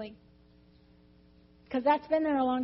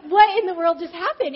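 loud, raised retold astonishment; acted bewilderment; 5 semitones higher, 8 dB louder than usual. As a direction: replay the shock at full volume. Repeats her acted bewilderment.

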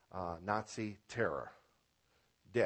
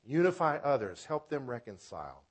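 ordinary delivery

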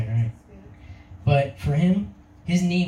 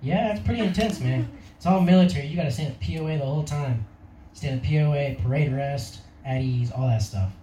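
ordinary talk, nothing marked

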